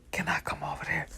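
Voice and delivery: hushed careful question; whispered ask. Retold or imitated. Calling Dre quietly.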